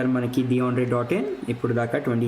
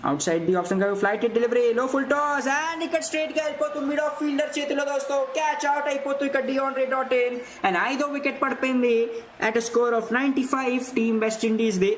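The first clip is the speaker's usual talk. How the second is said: excited cricket commentary; 9 semitones higher than usual. Real, not acted. Wicket falls, commentator excited.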